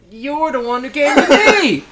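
high, loud, laughing outburst; indignant shout. Acted. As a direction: throw it back in mock outrage. Scrooge argues back at his employee.

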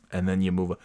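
normal talking voice

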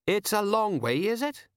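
high, rising questions. A narrator's reading. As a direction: lift the voice up high. Colon questioning.

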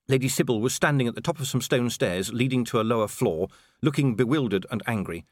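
ordinary delivery, nothing marked

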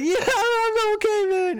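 high, slow, laughing through words; laughing reply. Retold or imitated. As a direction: squeak it out while cracking up.

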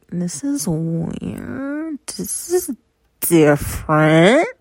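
slow, stretched-out uneasy reaction; slow mock-creeped-out "This is weird"; weirded-out drawl. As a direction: drag it out like something is off. Her reaction to men loving her voice.

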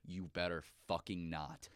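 low, quiet mock threat; retold stern warning. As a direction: warn her off softly, a death stare behind it. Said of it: "give her the death stare".

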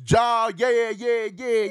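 high, exaggerated fake Jamaican patter; mock Jamaican accent. Host lampooning actors' attempts, mock Jamaican accent. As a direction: caricature a bad Jamaican accent. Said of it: "can't do Jamaican accents".

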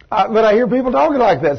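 usual speaking voice

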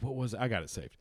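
normal talking voice

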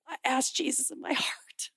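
hushed, solemn whisper; father whispering a heartfelt confession; serious whisper. Retold or imitated. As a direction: confide it quietly, almost too moved to speak. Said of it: "was having a serious moment".

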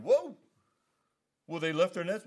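high, loud "Whoa" of disbelief; a sudden, loud, high "whoa"; mock surprise. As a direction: play the startled listener asking about the nets. Imagined listener's surprised reaction.

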